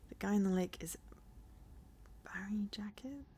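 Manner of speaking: whispered, hushed question; whispering. Real, not acted. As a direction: hush it out like a secret. Whispered reading.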